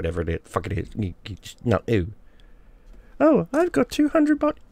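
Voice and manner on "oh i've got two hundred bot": high, squeaky mock voice of a bragging woman; mocking bragger. Ranting vlogger mimics a boastful woman in a high squeaky voice. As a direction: sneer it in a silly high voice.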